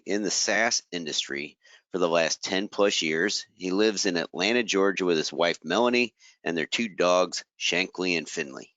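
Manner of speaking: ordinary talk, nothing marked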